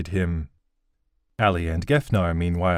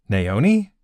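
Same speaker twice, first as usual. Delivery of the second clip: a louder, rising surprised call of the name; surprised blurt; 3 semitones higher, 5 dB louder than usual. Acted. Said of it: "Tanner blurted ... out of surprise".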